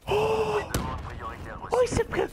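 high disbelieving cries; shocked outburst. Real, not acted. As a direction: yelp in shock.

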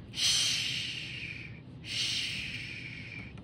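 long, hushing "sh"; SH sound. Shown as a demonstration. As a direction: draw out "sh" as pure breath. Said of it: "There's my S H".

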